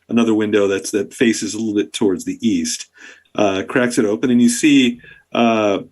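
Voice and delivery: ordinary talk, nothing marked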